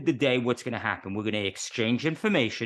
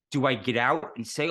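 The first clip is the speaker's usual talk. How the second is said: usual speaking voice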